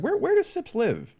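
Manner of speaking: quoted questioner. Retold or imitated. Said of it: "someone's like".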